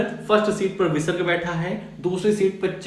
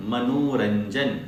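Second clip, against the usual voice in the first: slow demo; 4 semitones lower, much slower than usual. Shown as a demonstration. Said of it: "teacher demonstrates joined word".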